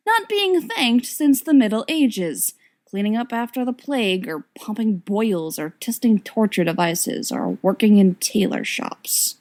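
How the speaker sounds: ordinary delivery, nothing marked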